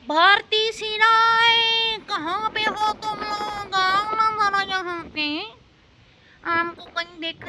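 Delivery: high, slow taunting call; mocking call-out. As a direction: call out to the army, daring them. Taunting Indian army, calling out. (ऊँची आवाज़ में चिढ़ाते हुए पुकारना)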